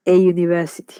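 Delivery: "A university" with a y-glide opening the noun; the Y sound. Shown as a demonstration. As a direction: say "A university" as "eh yoo-niversity". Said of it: "Ese es el sonido".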